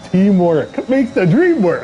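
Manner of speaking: loud, high celebratory cry; excited cheer. Real, not acted. Commentator cheering his team's pole lap, loud and high.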